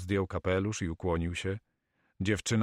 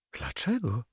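put-on higher, startled girl's question; unpleasantly surprised; 5 semitones higher than usual. A narrator's reading. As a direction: ask it taken aback, pitch jumping up. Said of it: "niemile zaskoczona". (niemile zaskoczona dziewczyna)

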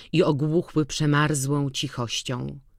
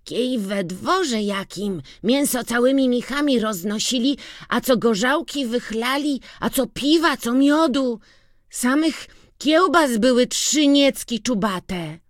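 excited boasting; 9 semitones higher than usual. A narrator's reading. Boy boasts of feast.

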